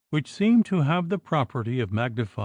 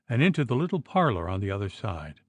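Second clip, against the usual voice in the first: usual speaking voice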